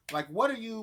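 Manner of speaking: normal talking voice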